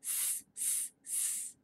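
long unvoiced S hiss; snake hiss sound. Shown as a demonstration.

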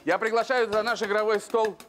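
usual speaking voice

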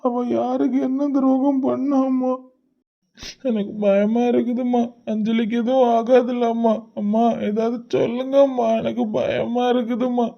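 crying. Acted. Son pleading with mother about injured Anjali, tearful. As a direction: beg through tears, scared for her. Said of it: "நீ இப்படி அழாத".

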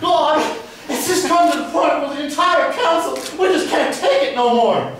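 high, loud mock hysterics; Kathy Kane. Angry resident mimicking a council member's outburst, mock distress. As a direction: imitate a distraught councilwoman at her wits' end. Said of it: "remember Kathy Kane?".

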